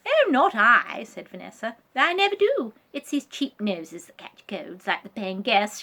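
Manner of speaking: sniffy and superior; Vanessa voice. Acted.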